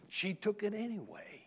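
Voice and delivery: whispered aside. Real, not acted. Preacher telling the congregation about the dog, whispered. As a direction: whisper it like a secret. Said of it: "whispered aside".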